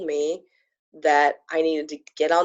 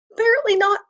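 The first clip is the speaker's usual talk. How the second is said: high, loud, exasperated apparently not; 7 dB louder, faster than usual. Real, not acted. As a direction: squeal it in mock despair. Disappointed about page not ready.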